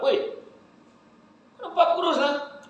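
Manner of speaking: very high exaggerated amazement; squeaky mock astonishment; teasing friend. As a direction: act amazed at how thin he looks. Fifth friend saying he's thin.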